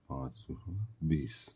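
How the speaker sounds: usual speaking voice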